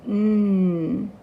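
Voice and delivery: a held, humming "N" sound, not the letter name. Shown as a demonstration. Teacher humming the N sound along with children. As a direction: hold the N as a hum with the kids. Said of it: "Let's try that together".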